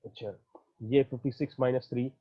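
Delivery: ordinary talk, nothing marked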